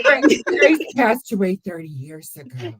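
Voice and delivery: high, loud, slow grandma voice; old grandma. Retold or imitated. Quoting the film's old grandma to the co-hosts, loud grandma impression. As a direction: imitate an old lady wailing it out. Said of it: "the old grandma comes in".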